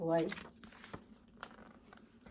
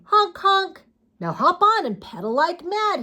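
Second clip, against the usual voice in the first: dad voice; 8 semitones higher, 4 dB louder than usual. Acted. Dad voicing horn and instructions.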